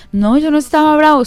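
mock defensive reply to the nagging sister; defensive denial. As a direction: deny it, a little offended. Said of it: "Y uno".

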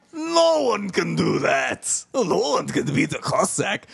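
slow, high shouted boast; mock Cossack boast. Host mocking the show's Cossack pride, shouted. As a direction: bellow it like a proud Cossack.

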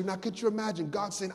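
usual speaking voice

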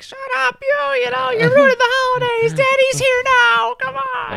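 very high, loud scolding scream; mom yelling. Retold or imitated. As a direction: scream at a relative to stop ruining it. Acting out mom in family fight.

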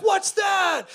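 high shouted surprise; loud high mock surprise; startled crowd. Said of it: "everybody's gonna be like".

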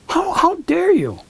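high-pitched mock outrage at himself; indignant self-scolding.

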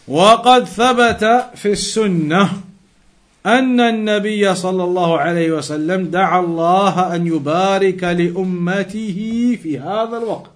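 quick, steady recitation of the Arabic passage; fast formal text reading. Acted. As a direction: read the classical text aloud briskly, like a scholar citing a source. Reads classical Arabic source text.

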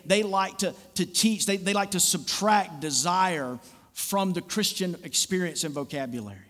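usual speaking voice

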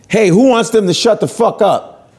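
rally the room against hecklers; calling to a crowd. Retold or imitated. Host asks the audience about hecklers, calling out.

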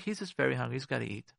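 softly, letting it go; soft understanding aside. Retold or imitated. To herself about her hungry husband, softly understanding. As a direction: say it gently under your breath. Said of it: "look at your husband like".

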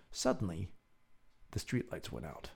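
soft, low, dropping into suspense; hushed suspense. A narrator's reading. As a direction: let it fall away quietly. Cliffhanger narration.

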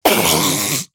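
imitated duck honking into a handkerchief; noisy mimicked nose-blowing quack. Shown as a demonstration. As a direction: blow your nose like a duck. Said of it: "Duck blowing nose on borrowed handkerchief".

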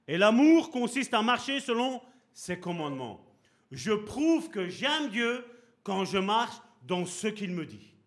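loud, emphatic preaching; preaching the verse. Acted. Preacher hammering the verse to the church, loud and emphatic. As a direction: drive the verse home like a pulpit preacher.

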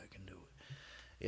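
slow breathy whisper to self. Real, not acted. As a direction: whisper it slowly, psyching up.

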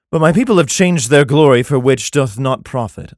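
measured, solemn Bible reading; scripture reading. Acted. Comedian reads Jeremiah to his audience, solemn scripture reading. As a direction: read it like King James scripture.

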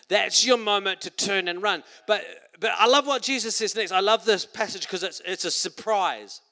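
ordinary talk, nothing marked

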